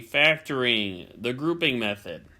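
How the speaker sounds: slow, drawn-out class reading; slow read-aloud. Shown as a demonstration. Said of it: "let's read it together".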